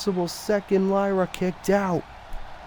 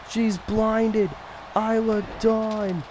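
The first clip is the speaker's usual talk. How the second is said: ordinary delivery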